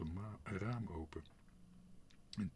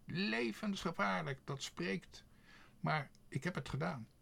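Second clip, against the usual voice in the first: high-pitched bragging bird voice; high, cocky crow character; boastful crow; 10 semitones higher than usual. A narrator's reading. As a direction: brag from mid-flight, pitched right up. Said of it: "schreeuwde Quark, ook vliegend".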